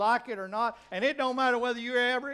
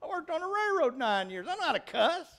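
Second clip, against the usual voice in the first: retold boast; 6 semitones higher than usual. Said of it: "I said".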